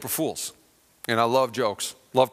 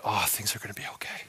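soft, low near-whisper; hushed relief; 6 semitones lower, 10 dB quieter than usual. Retold or imitated. Broke friend briefly hoping, hushed relieved whisper. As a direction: breathe it out as a quiet hope.

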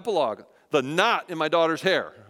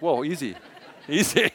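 laughing protest. Retold or imitated. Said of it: "I'm like".

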